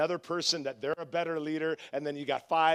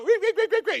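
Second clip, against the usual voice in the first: very high, loud rapid repeats; mock squeal; 14 semitones higher, 9 dB louder than usual. Pastor acting out the baptized man coming up, high mock squeal. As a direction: squeak it out in comic alarm.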